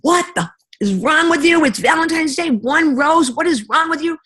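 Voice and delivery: high, loud exasperated yell at husband; mock tirade over one rose; angry yelling. Wife yelling at husband over Valentine's rose, high and loud. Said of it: "that's what I did on Valentine's Day".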